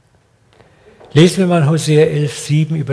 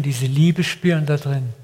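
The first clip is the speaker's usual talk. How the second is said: ordinary delivery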